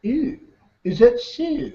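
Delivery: high, small, pinched-down frog voice; small-mouthed frog. Acted. Frog facing an alligator that eats big mouth frogs, small voice.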